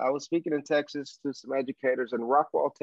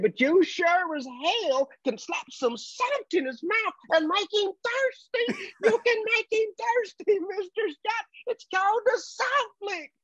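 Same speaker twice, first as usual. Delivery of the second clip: put-on thick Texan accent. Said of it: "and this thick accent".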